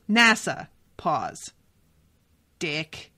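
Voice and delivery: "nasa," then a held pause, then a low, dropped "dick."; pause before the punchline. Retold or imitated. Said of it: "has really good delivery in those moments".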